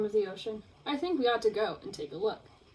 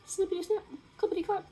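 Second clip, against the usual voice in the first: high chanting snip-snap; sing-song the snaps; light high chant; playful snapping; 8 semitones higher, faster than usual. Acted. Lobster snapping.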